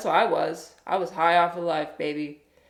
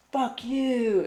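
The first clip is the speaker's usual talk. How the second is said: mock intense hippie; 6 semitones higher than usual. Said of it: "but he was like".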